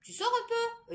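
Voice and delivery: lightly mimicked question; imitating him. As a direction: quote him, squeaky and light. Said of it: "il me dit".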